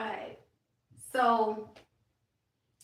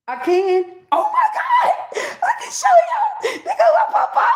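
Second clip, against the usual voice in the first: much higher, loud, laughing shout; overjoyed laughing shout; 12 dB louder than usual. Real, not acted. Seeing her grandfather's spirit appear, overjoyed laughing shouts. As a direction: shriek with joy through laughter.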